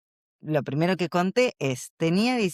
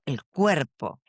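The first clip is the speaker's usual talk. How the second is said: usual speaking voice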